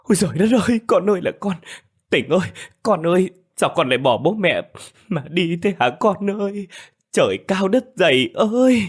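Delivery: high, keening shriek of grief; a mother's stricken lament; horrified wail. Acted. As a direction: cry out in shock at a son's death. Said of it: "kinh hãi rú lên".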